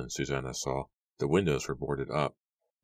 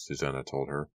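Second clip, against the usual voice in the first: usual speaking voice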